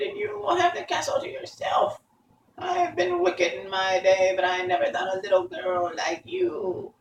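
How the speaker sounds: very high-pitched witch's dying speech; melting witch. A narrator's reading. Witch bids farewell as she melts, high wail.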